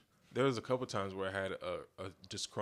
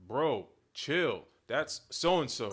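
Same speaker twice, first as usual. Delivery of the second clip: 1 friend warning; 5 semitones higher, 7 dB louder, much slower than usual. Retold or imitated.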